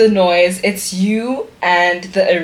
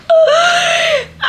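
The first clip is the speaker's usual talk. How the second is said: high-pitched crying gasp; tearful wail; 4 dB louder than usual. Real, not acted. Woman overcome by friends' letters, high tearful wail. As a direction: burst out in a high sob.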